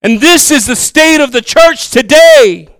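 high, loud and slow proclamation; loud declaration. Acted. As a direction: thunder it out slowly from the pulpit.

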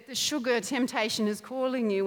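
ordinary delivery

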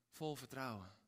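usual speaking voice

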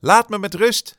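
Benny shouting at the crows to leave him alone. Acted. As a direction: scream to be left alone. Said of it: "schreeuwde hij".

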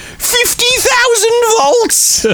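put-on high, proud drunk-fan exclamation; drunk fan's boast.

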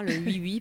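oui, oui said the Lorraine way, laughing; Lorrain oui. Shown as a demonstration. Apologizing to viewers for her Lorrain oui, oui. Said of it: "c'est mon accent lorrain".

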